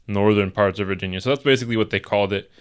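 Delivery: normal talking voice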